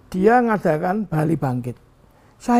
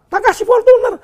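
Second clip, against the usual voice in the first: Tak kasih Fortuner much higher and louder, Fortuner hit hard; indignant outburst; 8 dB louder than usual. Real, not acted. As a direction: burst out in disbelief at what he gave. Indignant father listing gifts.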